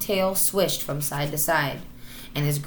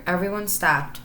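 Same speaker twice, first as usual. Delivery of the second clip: usual speaking voice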